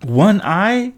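very high, astonished query; incredulous question. A narrator's reading. Passenger hearing of the drivers' one eye, incredulous. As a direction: echo it in disbelief.

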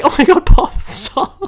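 high, loud mortified plea; panicked plea. Retold or imitated. Mortified high plea to grandad rolling down the window. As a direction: beg him to stop, mortified. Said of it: "I was like".

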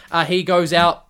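ordinary delivery

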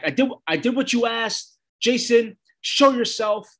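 high-pitched mock yell, not very loud; retold yell. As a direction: call out as if summoning someone, half-strength. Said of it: "she yells out loud".

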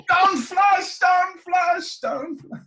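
high, loud comic yell, fading on the last; panicked shouting. Acted. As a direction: shout it from the pool as if sinking. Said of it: "he starts shouting".

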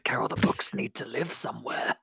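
urgent whispered husband; husband whispering insistently to Carol about books. Acted. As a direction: hiss it low and secret. Acted Brian, whispered.

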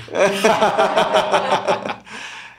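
loud burst of laughter; hearty laugh. Real, not acted. Joking about a favorite band with a friend, hearty laugh. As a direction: crack up at your own joke.

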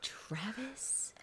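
unvoiced-sounding, soft whisper with a questioning rise; whispered query. Real, not acted. Reacting to who asked the question, hushed whisper. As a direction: whisper the name in disbelief.

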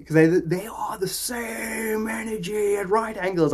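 slow, high, deliberate emphasis; emphatic. Real, not acted. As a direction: lean on every word slowly. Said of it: "I can't emphasize that enough".

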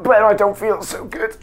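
panicked. Acted. Panic scene.

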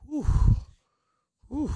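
short puffed-out breaths; out-of-breath exhale after dancing; winded whew. Real, not acted. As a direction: blow out air, catching your breath. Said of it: "I got out of breath".

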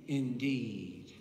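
"indeed" low and drawn out; emphatic INDEED. Acted. Preacher quotes the Son's promise, weighty emphatic "indeed". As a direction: stretch "indeed" slowly as a weighty yes. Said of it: "It's an emphatic yes".

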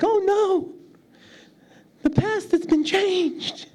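very high falsetto movie panic; mock alarm. Movie characters see somebody blipping out, mock alarm. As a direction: wail it slowly in a hammed-up squeak.

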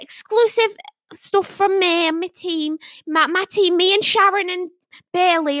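high, sing-out Yorkshire sales patter; Yorkshire psychic impression. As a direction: pitch to livestream viewers in a put-on Yorkshire accent. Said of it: "Yorkshire accent".

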